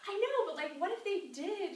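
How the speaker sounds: mock upbeat encouragement; eager pitch. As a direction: nudge her along brightly. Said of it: "I'm like".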